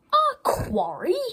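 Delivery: high rising shocked question; shocked disbelief. A narrator's reading. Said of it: "said Salty".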